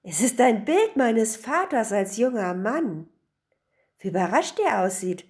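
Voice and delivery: mildly amazed, key words lifted; a touch of wonder; astonished. A narrator's reading. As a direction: marvel at an unexpected find. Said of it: "rief erstaunt".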